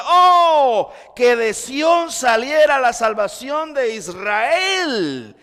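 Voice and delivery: loud, slow, longing declaration; fervent cry. Acted. Preacher voicing the psalmist longing for salvation, loud and slow. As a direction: cry it out slowly, yearning for salvation. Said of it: "Él dice".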